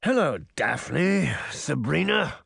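slow, stretched villain greeting; diabolical villain. A narrator's reading. Mirror appears to the two girls, diabolically greeting them. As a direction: draw the greeting out with mocking relish. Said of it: "both dark and diabolical".

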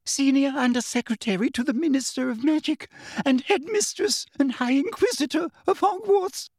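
very high, frightened reeling-off of titles; scared official squeak; high-pitched, terrified. A narrator's reading. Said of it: "in a high-pitched, terrified voice".

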